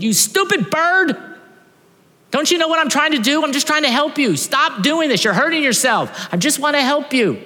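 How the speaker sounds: high, loud frustrated yell at a bird; frustrated shout. Retold or imitated. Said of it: "He gets so frustrated".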